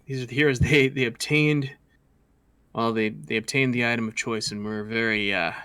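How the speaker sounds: ordinary talk, nothing marked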